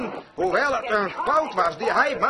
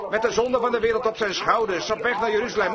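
usual speaking voice

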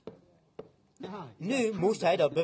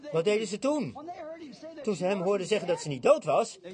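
usual speaking voice

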